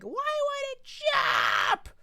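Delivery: put-on high-pitched screaming kid; slow, shrieking kid rage; raging kid. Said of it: "kids raging on Geometry Dash".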